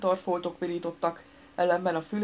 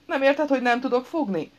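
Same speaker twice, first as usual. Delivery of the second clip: higher, louder exasperated plea; whimpering plea; 7 semitones higher, 5 dB louder than usual. A narrator's reading. Injured man to his pals who won't help, whimpering. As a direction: plead helplessly, pitched up. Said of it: "Szűkölt, a balul járt".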